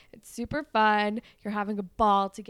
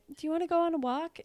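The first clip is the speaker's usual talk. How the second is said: higher, fast casual question; lightly voiced cute guy asking; boy asking; 7 semitones higher, faster than usual. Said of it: "And then he's like".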